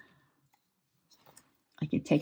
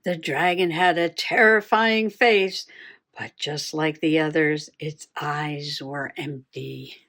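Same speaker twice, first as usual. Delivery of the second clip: dramatic story reading; 4 semitones lower than usual. Acted. Rereading the dragon story.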